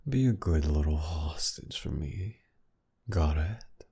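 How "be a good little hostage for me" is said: usual speaking voice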